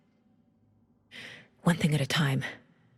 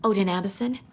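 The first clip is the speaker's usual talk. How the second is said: ordinary talk, nothing marked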